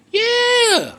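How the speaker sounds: squeaky yeah. Real, not acted. Mock high squeal after name.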